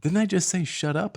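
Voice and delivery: high, loud puzzled protest; mock bafflement. Dad accused of swearing, baffled protest. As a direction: act out a dad doubting himself.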